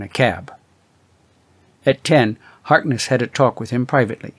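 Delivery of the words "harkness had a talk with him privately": plain reading voice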